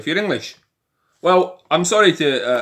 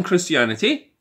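ordinary talk, nothing marked

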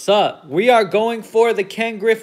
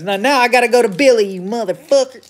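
fast, higher and louder mock manager; manager voice again; 4 semitones higher, 3 dB louder, faster than usual. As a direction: rant as the manager heading to the mound. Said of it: "No, I'm just kidding".

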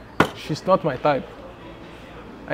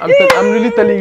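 pleading squeal. Acted. Pleading his case.

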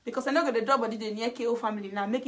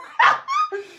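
very high squeal of laughter; high laughing squeal; shrieking laugh; 8 dB louder than usual. Real, not acted. Laugh after quote.

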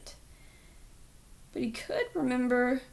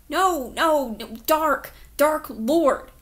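"Dark" said loud and firm with a clear "ah" vowel, insisting it's not "Dirk"; insistent correction; 2 semitones higher, 8 dB louder, slower than usual. Acted. Boy correcting the officer, loudly stressing "Dark". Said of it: "his voice came out wrong, weak and raspy".

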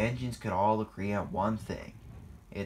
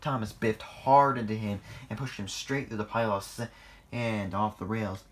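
usual speaking voice

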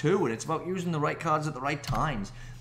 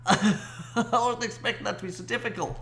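laughing; 8 semitones higher than usual. Real, not acted. Laughing after win.

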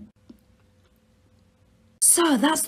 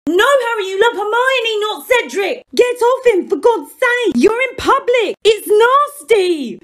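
high scolding voice; 10 semitones higher than usual. Acted. McGonagall scolding Harry and Cedric.

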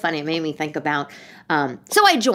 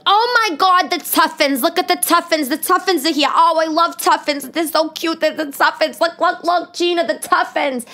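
put-on New York accent; 9 semitones higher than usual. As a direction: gush like a thick-accented New Yorker. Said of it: "she had a thick New York accent".

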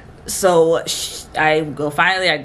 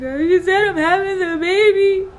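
loud, high-pitched imitated wail; hammed-up tearful cry; mock bawling; 13 semitones higher, 5 dB louder than usual. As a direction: act out bawling to your husband. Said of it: "I'm bawling".

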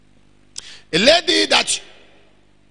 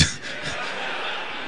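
laughing; 12 dB quieter than usual. Real, not acted. Preacher laughs at audience.

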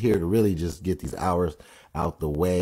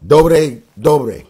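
1 playful Russian; 8 semitones higher, 12 dB louder than usual. Shown as a demonstration. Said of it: "So, dobre utro".

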